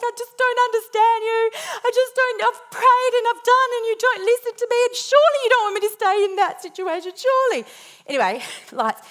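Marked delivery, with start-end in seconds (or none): 0.0-7.6 high, loud, teary pleading; crying out to God, much higher and louder; tearful pleading. Retold or imitated. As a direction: plead with God through tears. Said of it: "And I'm like".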